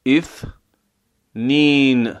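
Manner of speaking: اثنین drawn out, the ث with the tongue tip on the teeth; slow Arabic ithnayn. Shown as a demonstration. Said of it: "نوک زبانی از برخورد نوک زبان".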